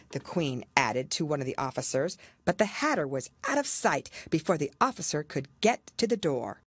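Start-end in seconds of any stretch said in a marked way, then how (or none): none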